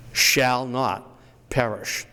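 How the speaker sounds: slow and weighty like a commandment. Shown as a demonstration. Historian intoning Lincoln's line slowly like a commandment. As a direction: pronounce it like law from the mountain. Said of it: "That's a commandment".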